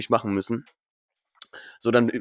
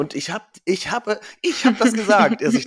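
laughing, much higher and louder; 10 semitones higher, 3 dB louder than usual. Real, not acted. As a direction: laugh through the words. Laughing while complaining.

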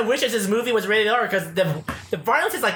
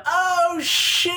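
high, drawn-out comic scream; mock falling yell; 12 semitones higher than usual. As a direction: yell it long like a doomed villain tossed skyward. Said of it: "She yells out".

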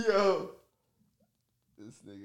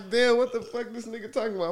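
much higher, baffled; confused disbelief; 13 semitones higher, 3 dB quieter than usual. Retold or imitated. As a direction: sound lost and amazed. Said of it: "I'm like".

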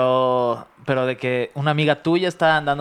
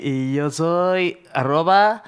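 slow motion; much slower than usual. Shown as a demonstration. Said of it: "te voy a hablar súper lento".

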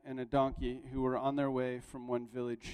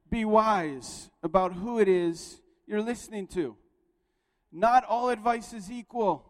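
fervent preaching; 6 semitones higher, 5 dB louder than usual. Acted. Pastor urging the congregation to choose advisers wisely, fervent and raised. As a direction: drive the moral home from the pulpit. Preaches the moral at full force.

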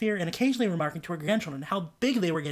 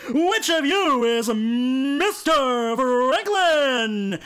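sweepstakes spokesman; 6 semitones higher, 5 dB louder, much slower than usual. A narrator's reading. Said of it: "asked the sweepstakes spokesman".

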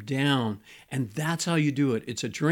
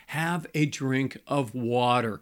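slow and steady, spelling out the advice; slow, deliberate advice. Shown as a demonstration. Pastor advising angry couples, slow and deliberate. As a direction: lay it out slowly, each word weighed. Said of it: "But my point is this".

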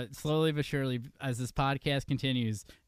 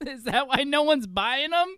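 laughing; 11 semitones higher, 7 dB louder than usual. Real, not acted. Joking mock question, laughing.

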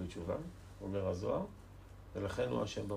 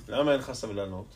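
ordinary delivery

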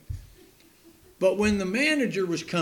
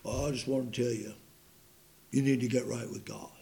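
put-on a lightly lowered, softer old preacher's voice; John R. Rice preaching; 5 semitones lower, 6 dB quieter, faster than usual. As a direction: imitate the old preacher, low and hushed. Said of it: "he talked like this when he preached".

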